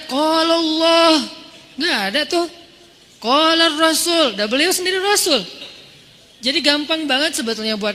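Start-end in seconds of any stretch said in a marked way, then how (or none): 0.1-1.2 loud, drawn-out mock preacher citation; declaiming proof texts. Mimicking a preacher flaunting proofs, declaimed. 3.2-4.4 slow, raised mock citation; declaiming proof texts. Mimicking a preacher quoting the Rasul, declaimed.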